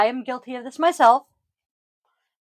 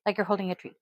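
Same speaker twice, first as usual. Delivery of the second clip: normal talking voice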